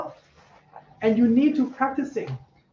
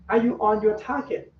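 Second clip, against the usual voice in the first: usual speaking voice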